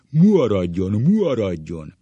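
loud, swollen-vowel command; hammed-up teacher impression; booming teacher voice. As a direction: bark it like a stern schoolmaster. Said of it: "a sárgaság hangon".

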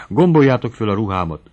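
understated gasping plea; rasping. A narrator's reading. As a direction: rasp it out short of breath. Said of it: "hörögte végre ziháló mellel".

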